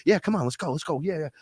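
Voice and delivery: high, excited egging-on; play-acted high-pitched encouragement; mock eager coaxing. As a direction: coax them along with giddy urgency. Egging them on to blow candle.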